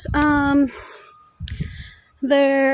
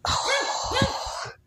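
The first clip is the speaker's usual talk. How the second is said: high, hushed pity for a bird; cooing over a dead bird; 11 semitones higher, 5 dB quieter, much slower than usual. Real, not acted. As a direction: sigh it out high like to a small creature. Sees a run-over bird.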